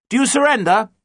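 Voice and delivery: high, loud challenge; shouting up. A narrator's reading. Captain challenges the Amazons on the lookout, shouting up. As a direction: shout it up to someone on the heights. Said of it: "John shouted up to the Amazons".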